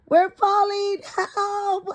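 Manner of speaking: high squeaky cry for help; mock panic. Kids tumble down the hole, high mock panic. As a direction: squeal it like a falling kid. Kids falling through the earth.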